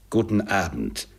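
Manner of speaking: understated call, firmly closing the talk; called-out dismissal. A narrator's reading. Scrooge sends his nephew away, calling out. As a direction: dismiss him with a falling tune. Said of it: "rief Scrooge".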